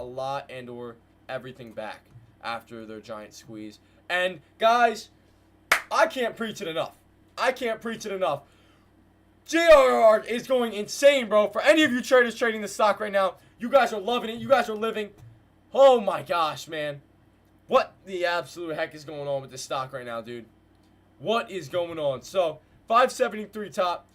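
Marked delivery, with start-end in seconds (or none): 4.1-15.1 excited shout. Real, not acted. Hyped by stock spiking. 15.7-24.1 amazed exclaiming. Real, not acted. Stunned at stock's rise.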